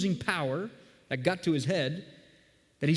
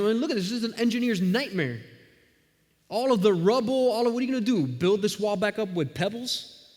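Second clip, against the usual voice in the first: higher, scornful heckling; mocking jeer; 6 semitones higher than usual. Another man heckles the builders, higher scornful jeer. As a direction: jeer at the rubble like a smug critic. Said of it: "the other guy chimes in".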